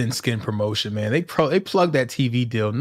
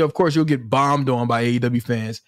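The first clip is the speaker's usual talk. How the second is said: ordinary delivery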